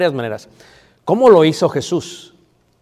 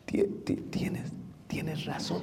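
slow halting whisper, forcing out tienes razón; struggling whisper; 3 semitones higher, 15 dB quieter, slower than usual. Shown as a demonstration. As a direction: whisper it as if the words hurt to say. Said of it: "Es difícil, hermano, decir". (susurro lento y trabado, como si costara decirlo)

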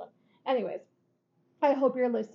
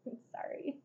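whispered sorry; 17 dB quieter than usual. Retold or imitated. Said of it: "And I'm like".

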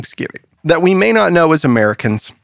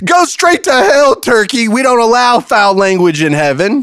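high, indignant scolding; mock-outraged rebuke; 9 semitones higher, 3 dB louder than usual. Apostle sends a foul-mouthed turkey away, mock outrage. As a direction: banish them with hammed-up outrage.

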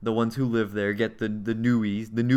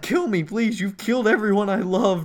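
high, loud hammed-up begging; mock anguished scream; 8 semitones higher, 4 dB louder than usual. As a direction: scream out a despairing plea in imitation. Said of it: "So she's just screaming".

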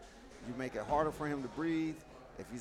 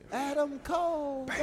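Adam Cole catchphrase; 11 semitones higher than usual. Retold or imitated. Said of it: "if you gonna say it".

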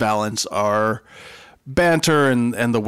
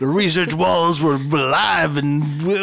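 stoned hippie voice; 5 semitones higher than usual. Pretend. Jokes about LSD episode research.

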